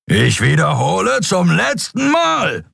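high, slow, strained roar. A narrator's reading. Captain giving the fleeing ship a last warning, roaring. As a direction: bellow a final warning. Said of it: "brüllte Sron".

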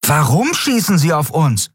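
high-pitched, panicky question; alarmed. A narrator's reading. Miko asks Kai about the attack, alarmed. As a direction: demand a reason in alarm.

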